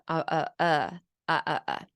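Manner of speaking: every "uh" same stress and length; even stress. Shown as a demonstration. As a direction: babble with no stressed syllable. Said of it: "even stress".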